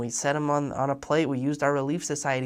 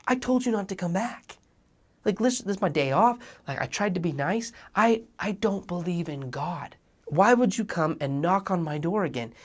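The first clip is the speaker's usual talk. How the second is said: higher, louder annoyed rant at the door; mock outrage, half-acted; imitating a furious man; 6 semitones higher, 5 dB louder than usual. Atheist neighbor scolding the returning missionaries, mock angry. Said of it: "is so furious".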